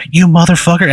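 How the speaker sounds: an enraged mob shouting at you; angry crowd yell. Retold or imitated. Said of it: "these people going".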